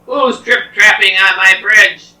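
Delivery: loud, booming troll call; challenging troll. Acted. Troll challenges little goat on his bridge, loud demand.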